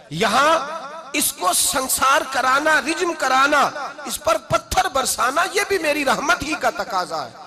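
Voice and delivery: high, slow, emphatic preaching; forceful preaching. Acted. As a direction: stretch the opening, then hammer out each phrase. (पुरज़ोर, ठहर-ठहर कर ऊँची तक़रीर)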